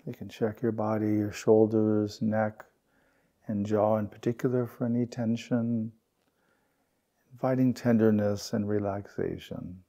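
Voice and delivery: unhurried meditation guide, long pauses between phrases; guided meditation. Real, not acted. Teacher guiding the group through belly breaths and settling, guided meditation.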